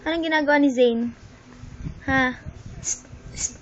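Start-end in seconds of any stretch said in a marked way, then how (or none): 2.8-3.5 whispered psst. Real, not acted. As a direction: hiss for attention. Hushing to get baby's attention.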